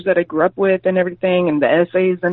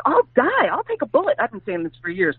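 mock fervor; 6 semitones higher than usual. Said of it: "And I'm like".

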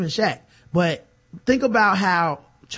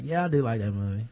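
sheepish mumble; 10 semitones lower, 8 dB quieter, faster than usual. Retold or imitated. Guy's sheepish reply voiced.

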